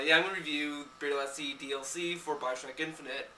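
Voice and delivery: usual speaking voice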